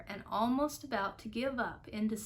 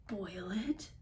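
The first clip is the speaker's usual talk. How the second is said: breathless unvoiced whisper; gasped whisper; 4 dB quieter than usual. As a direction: gasp it out in a whisper. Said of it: "gasped Horton".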